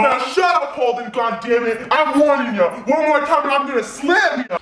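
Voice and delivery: high, heated threat; furious warning. Acted. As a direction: warn him at the end of your patience. Roommate threatens him during fight.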